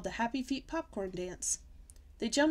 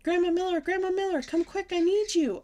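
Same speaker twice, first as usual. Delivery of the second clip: high, loud panicked call; frightened shout; 10 semitones higher, 7 dB louder than usual. Acted. Girl terrified of the monster calls grandma, shouting. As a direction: scream for help. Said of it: "I shouted".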